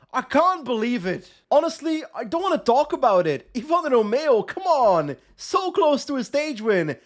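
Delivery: ordinary talk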